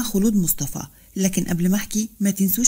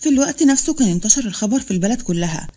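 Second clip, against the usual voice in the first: ordinary delivery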